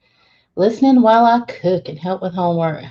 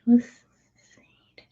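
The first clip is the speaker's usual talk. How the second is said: whisper; 2 semitones higher, 4 dB quieter than usual. Real, not acted. Whispers to herself scrolling.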